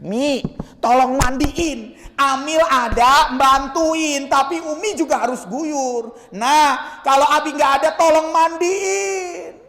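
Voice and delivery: slow, pleading, ending in a sob; husband asking wife to bathe his body, crying; tearful request. Acted. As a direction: beg your wife gently through tears. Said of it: "Ngomong dari sekarang sama istri kita".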